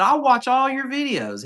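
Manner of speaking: high-pitched, enthusiastic quote of a fan; excited fan, retold. Store owner tells a customer he watches his videos, excited.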